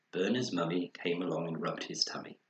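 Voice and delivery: ordinary delivery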